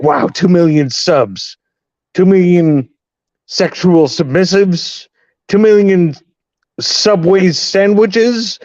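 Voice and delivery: mock pro wrestler announcing a milestone, high and slow; pro wrestling promo. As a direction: ham up a slow, high wrestling-hype call. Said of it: "like, a pro wrestling promo video".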